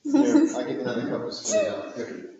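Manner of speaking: laughing through the words, then a whispered giggle; can't hold back the laughter. Real, not acted. Laughing at his wife while she spits salt water.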